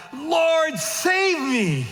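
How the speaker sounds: loud, high desperate plea; cry for help. Acted. Peter crying out while sinking, desperate and loud. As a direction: cry out as if drowning. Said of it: "and he cried out".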